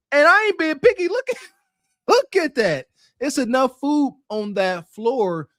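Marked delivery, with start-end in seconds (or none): 0.1-2.9 higher and louder, laughing in disbelief; can't believe the mess, laugh through it; outraged disbelief. Real, not acted. Disgust at dirty car.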